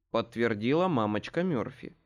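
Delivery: plain reading voice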